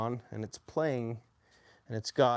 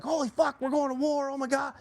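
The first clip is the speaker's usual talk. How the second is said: frantic mock alarm, pitched way up; panicked drill sergeants; 14 semitones higher than usual. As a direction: imitate sergeants losing their minds. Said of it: "the drill sergeants were all freaking out".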